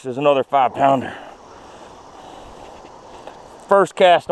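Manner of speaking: excited yelling while reeling in; thrilled, breathless fish-on commentary; fighting a fish. Real, not acted. Hooked big fish, excited play-by-play.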